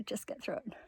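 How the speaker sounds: whispering to herself, urging; a quiet whisper to keep going; whispered self-pep talk. Real, not acted.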